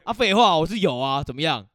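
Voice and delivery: mock defiant snap. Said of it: "我就直接说".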